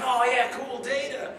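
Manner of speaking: high, loud fanboy enthusiasm; mock excited. Moviegoer recognizes the actor as Data, mock excited. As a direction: exclaim like a thrilled fan, hammed up.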